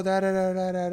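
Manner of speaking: normal talking voice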